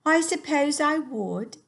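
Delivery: young Sophie in a put-on English accent. Teacher reading to her class as Sophie, English accent. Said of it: "an English accent".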